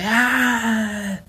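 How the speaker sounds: high, drawn-out mock wail; old woman's wail. Imitating the old woman screaming outside, high wail. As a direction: wail like an old lady in the street. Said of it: "como de una señora".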